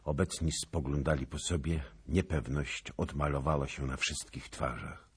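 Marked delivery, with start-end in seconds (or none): none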